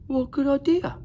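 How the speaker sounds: high, bright dragon voice; squeaky young dragon, delighted; eager dragon Zog. A narrator's reading. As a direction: chirp it out like a happy young dragon. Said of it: "said Zog".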